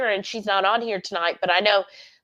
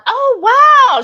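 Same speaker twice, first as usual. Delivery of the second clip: high, loud burst of delight; jolted into real surprise; surprised wow; 12 dB louder than usual. Real, not acted. Spotting a loyal member on the live chat, delighted. Spots a long-time member.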